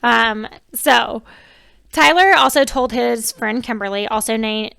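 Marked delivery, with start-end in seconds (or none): none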